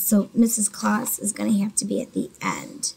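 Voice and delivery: quiet unvoiced whisper; teacher whispering an explanation. Real, not acted. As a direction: hush it to the class. Whispered aside.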